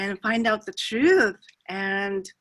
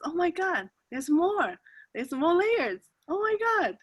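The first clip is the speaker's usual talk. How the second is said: high-pitched, gushing wonder; mock amazement; 9 semitones higher than usual. Reacting to finding more layers, high-pitched excitement. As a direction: play up amazed discovery in a raised voice.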